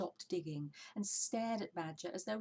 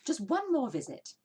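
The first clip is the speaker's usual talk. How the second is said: louder, higher, eager cry; 7 semitones higher, 10 dB louder than usual. Acted. Mister Fox crying out to Badger, eager. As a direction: announce it with relish. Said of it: "cried Mister Fox".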